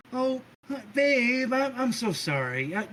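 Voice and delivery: high, cooing apology; sweet apology. Acted. Sister apologizing to her babe, high cooing. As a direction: soften up, sorry and sweet.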